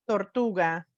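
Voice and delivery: ordinary talk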